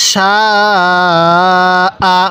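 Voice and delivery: "সা" held very long, then a short "আ"; long madd. Shown as a demonstration. Teacher shows the four-alif rule, long drawn-out "সা" then short "আ". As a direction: stretch "সা" four counts before cutting to "আ". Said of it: "আমার সাথে সাথে টানটা দিবেন".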